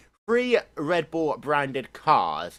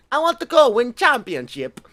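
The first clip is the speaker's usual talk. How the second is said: high, loud mock-eager driver voice; Massa impression; 10 semitones higher, 8 dB louder than usual. Mimicking Massa after Schumacher left, high and loud. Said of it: "Then Massa was like".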